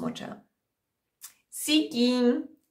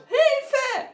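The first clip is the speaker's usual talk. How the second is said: high screamed cry for help; 3 dB louder than usual. A narrator's reading. Girl screams for help as the basket falls. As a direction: shriek it out in terror. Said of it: "Rotkäppchen schrie laut".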